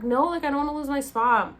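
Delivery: loud, urgent protest; insistent. Retold or imitated. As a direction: push back, panicked about losing it. Pleading not to lose booking.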